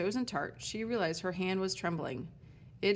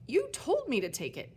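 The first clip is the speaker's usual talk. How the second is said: higher, louder outraged protest; indignant protest; 6 semitones higher, 3 dB louder than usual. Acted. Elsbeth protests to the sign, higher and outraged. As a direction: protest the unfair charge.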